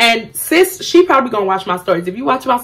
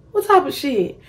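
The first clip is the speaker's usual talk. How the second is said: incredulous; 5 semitones higher, 3 dB quieter than usual. Retold or imitated. Retold sharp question.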